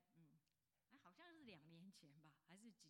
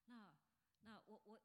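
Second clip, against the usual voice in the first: ordinary talk, nothing marked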